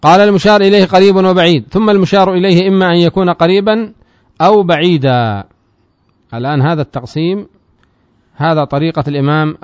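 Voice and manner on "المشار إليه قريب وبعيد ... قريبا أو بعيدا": raised, louder reading of the matn; text recitation. Acted. Teacher reads the matn aloud to students, raised recitation. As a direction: declaim the source text to the class.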